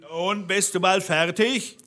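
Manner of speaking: calling up from below. A narrator's reading. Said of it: "Da rief der Blaubart unten".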